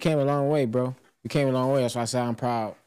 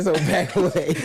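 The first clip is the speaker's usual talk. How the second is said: laughing echo; 6 semitones higher, 4 dB louder than usual. Retold or imitated. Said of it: "He said".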